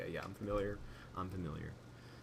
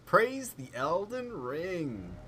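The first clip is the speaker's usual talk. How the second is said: ordinary delivery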